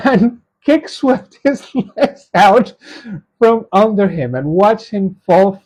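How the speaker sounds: high, slowed reading broken up by laughter; laughing while reading. Real, not acted. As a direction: read it out while cracking up. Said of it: "sorry for laughing".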